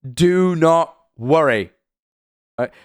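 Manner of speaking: very slow, each word separated; emphatic reassurance. Real, not acted. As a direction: spell it out slowly to calm them. Emphatically reassuring panicking chat.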